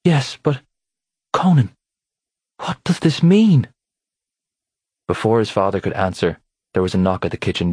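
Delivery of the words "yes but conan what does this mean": high, louder, slow frightened wife; pleading in fear, voice rising; frightened plea. A narrator's reading.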